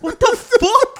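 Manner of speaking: high, loud, laughing disbelief; laughing outburst. Real, not acted. Friends cracking up over the nephew's demand, laughing. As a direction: burst out laughing in shock.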